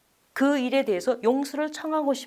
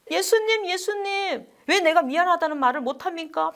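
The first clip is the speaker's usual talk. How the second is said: crying out to Jesus; 6 semitones higher than usual. Retold or imitated. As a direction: cry out to Jesus in frustration. 성경을 탁 때리며 예수님께 호소. (성경을 치며 예수님께 높고 크게 호소하듯)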